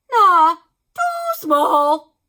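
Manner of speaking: slow, high, loud and drawn-out; dismissive doctor. A narrator's reading. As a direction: drag it out, scornful. Doctor judging needle.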